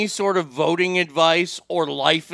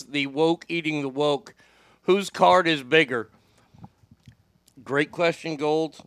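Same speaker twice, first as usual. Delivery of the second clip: normal talking voice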